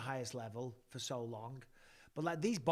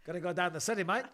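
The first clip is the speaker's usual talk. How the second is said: playful mock Kiwi accent, raised; New Zealand accent; 3 semitones higher, 4 dB louder, faster than usual. Host asked for New Zealand, in a mock Kiwi accent. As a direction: ham up New Zealand vowels.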